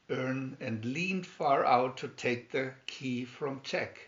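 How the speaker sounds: plain reading voice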